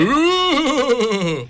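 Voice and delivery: long, high, loud "ooh" like a cartoon ghost; mock ghost wail. Ghost noise after announcing ghost story.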